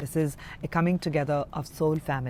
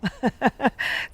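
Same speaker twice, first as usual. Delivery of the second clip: high, breathy laugh through emotion; emotional laugh; 9 semitones higher than usual. Real, not acted. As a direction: laugh as feelings spill over. Overcome by emotion ending reading.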